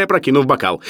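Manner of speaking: plain reading voice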